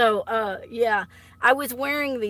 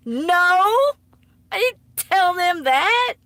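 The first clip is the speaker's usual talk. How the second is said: loud denial; 8 semitones higher, 8 dB louder, slower than usual. Real, not acted. Denying mom's story loudly.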